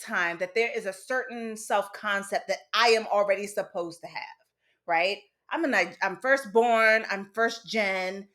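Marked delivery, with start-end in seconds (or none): none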